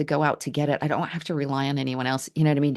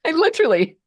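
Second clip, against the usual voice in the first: laughing; 6 dB louder, faster than usual. Real, not acted. Laughing at the memory.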